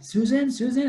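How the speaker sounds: high-pitched mock calling out; imitated worried calling. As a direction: play a boy calling for her. Boy calls to Susan after scream.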